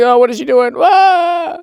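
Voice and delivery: hammed-up frantic sobbing cry; panicked mock wail. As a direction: fret out loud like he's losing it. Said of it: "He's like".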